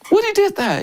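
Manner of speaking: can't believe he painted that; high astonished question; amazed question. Retold or imitated. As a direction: pitch jumps way up in wonder.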